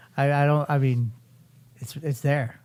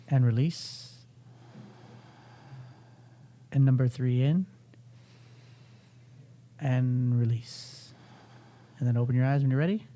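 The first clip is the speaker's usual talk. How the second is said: guided breathing. Acted. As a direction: talk them through each breath, unhurried. Leads a breathing exercise.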